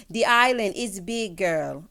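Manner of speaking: put-on half-hearted Jamaican accent, a bit slow; attempted Jamaican accent. Podcaster quoting cast member on Jamaica, rough Jamaican accent. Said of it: "I ain't got the accent".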